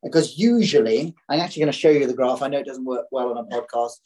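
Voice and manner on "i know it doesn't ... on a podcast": ordinary delivery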